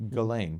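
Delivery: Ghislaine as "guh-lane"; hard G, silent S. Shown as a demonstration. Explaining to co-host how he's heard it, "guh-lane" pronunciation. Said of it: "I've heard it pronounced".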